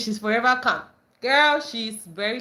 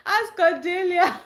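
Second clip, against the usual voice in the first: laughing; 9 semitones higher, 3 dB louder, slower than usual. Real, not acted. Laughing at comment.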